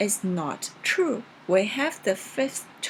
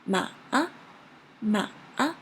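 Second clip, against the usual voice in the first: "Ma, a" starting low, twisting, then rising; dipping tone. Shown as a demonstration. Teacher demonstrating third tone, low dip then rise on "Ma, a". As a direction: dip deep on "Ma" and swing up on "a". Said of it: "Start really low".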